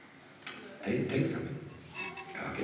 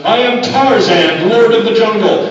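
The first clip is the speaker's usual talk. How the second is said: loud, high, slow jungle-king shout; chest-pounding jungle roar of a line; Tarzan shout; 8 semitones higher, 7 dB louder than usual. Shown as a demonstration. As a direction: bellow like Tarzan. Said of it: "stand up and shout".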